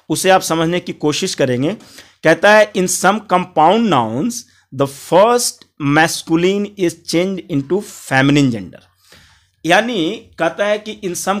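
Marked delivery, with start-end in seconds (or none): 2.7-8.9 very slow, every word spaced out; slow rule dictation. Shown as a demonstration. Teacher reading the compound noun rule to students, very slow. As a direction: dictate the rule slowly so students can copy it.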